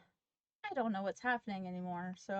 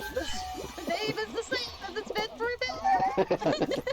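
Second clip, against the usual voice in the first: hammed-up announcer hype, higher; wrestling promo; 10 semitones higher than usual. Mock announcement about wrestler mode.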